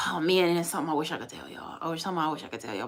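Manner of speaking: ordinary delivery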